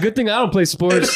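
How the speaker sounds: laughing shout. Retold or imitated. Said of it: "Karina's like".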